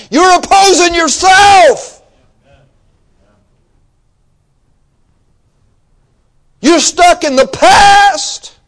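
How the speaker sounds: loud, high shouts with stretched key words; angry-sounding preaching. Real, not acted. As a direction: thunder it at the congregation. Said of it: "I sound like I'm angry".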